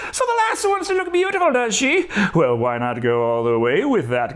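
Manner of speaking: high, gleeful Irish leprechaun taunt, building to a shout. Pretend. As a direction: gloat like a mischievous leprechaun, rising to a yell at the end. Narrator mimics the leprechaun gloating.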